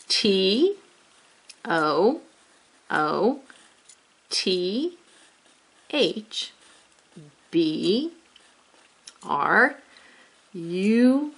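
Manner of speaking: "T", "O", "O" each letter named slowly with pauses; spelling letter by letter. Shown as a demonstration. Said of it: "Let's begin".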